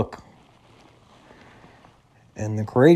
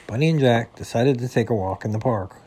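usual speaking voice

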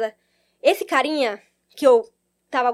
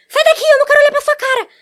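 much higher, louder hostile snap; angry retort; 12 semitones higher, 8 dB louder than usual. Retold or imitated. The girl yelling at the narrator, a loud angry quote. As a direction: throw it back at her, furious. Girl rejects her offered help.

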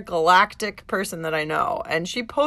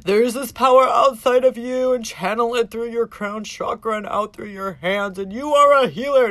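louder, grandiose healer spiel; mock traditional Reiki teacher; 3 semitones higher, 6 dB louder than usual. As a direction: parody a mystical teacher proclaiming. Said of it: "in the fucking traditional way".

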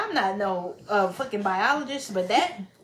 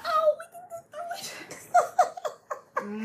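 mock tearful plea, pitched high; high crying plea; much faster than usual. Partner begging the man in a high, mock-crying voice. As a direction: plead through fake tears, high up.

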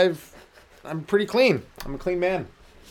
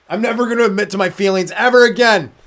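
vow; 7 semitones higher, 9 dB louder than usual. Retold or imitated. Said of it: "He's like".

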